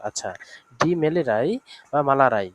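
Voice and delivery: ordinary talk, nothing marked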